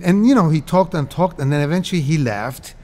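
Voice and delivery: ordinary talk, nothing marked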